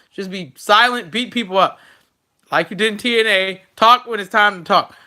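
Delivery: usual speaking voice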